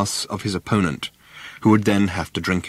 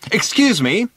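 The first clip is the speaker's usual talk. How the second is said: calling out high across the site; shouted; 12 semitones higher, 3 dB louder than usual. A narrator's reading. Ford calling across to Mister Prosser, high shout. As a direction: call out to get attention. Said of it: "he shouted".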